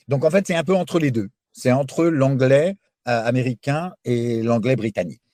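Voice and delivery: ordinary delivery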